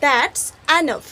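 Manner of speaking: very slow, loud model delivery; teacher drilling English slowly; slow drilled phrase. Shown as a demonstration. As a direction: spell it out slowly for learners. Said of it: "কি বলবে".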